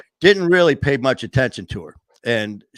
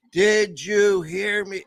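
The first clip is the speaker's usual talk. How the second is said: slow and higher, every word stretched; mock slow nurse talk; 7 semitones higher, much slower than usual. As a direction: imitate her talking down to someone deaf. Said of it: "she started talking like".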